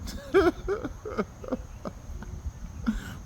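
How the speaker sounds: breathless, soundless wheezing laugh; silent wheeze laugh. Real, not acted. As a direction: laugh with no voice, just air.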